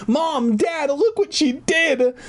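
high, whiny sister's screech; acted screaming. A narrator's reading. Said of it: "Sarah screamed".

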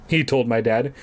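plain reading voice

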